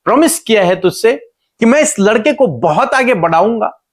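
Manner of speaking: high, emphatic, insistent preacher's voice; God reasoning with Hagar. Retold or imitated. God explains His promise to crying Hagar, high insistent reasoning. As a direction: press each question and promise home, pitched high. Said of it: "परमेश्वर उसे समझाने की कोशिश".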